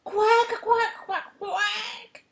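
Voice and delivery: high, squawking attempt at crowing in quacks; loud duck quacks. A narrator's reading. Duck showing rooster how to crow, loud high quacking. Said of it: "and crowed".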